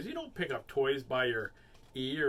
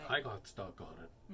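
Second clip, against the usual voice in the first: low, soft excuse; deadpan repetition; 4 semitones lower, 7 dB quieter than usual. Pretend. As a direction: repeat it quietly like a stuck record. Stuck-record repetition of excuse.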